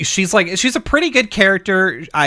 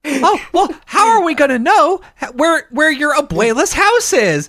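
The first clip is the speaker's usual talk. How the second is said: very high falsetto puppet; squeaky little puppet asking innocently; 11 semitones higher than usual. Acted. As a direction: imitate a kids' show monster in high falsetto. Voices Elmo answering the iguana.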